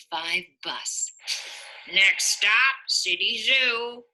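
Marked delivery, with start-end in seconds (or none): 1.9-4.0 lightly voiced bus driver calling out. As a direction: call the stop like a driver. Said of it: "the bus driver would call".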